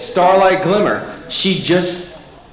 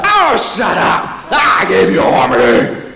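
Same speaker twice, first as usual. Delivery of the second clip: loud mock villain shout, slow; 3 semitones higher, 3 dB louder, slower than usual. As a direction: bark it like a furious villain. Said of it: "she's like".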